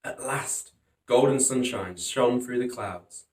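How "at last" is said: usual speaking voice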